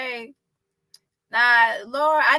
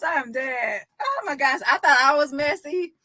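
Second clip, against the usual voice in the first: ordinary talk, nothing marked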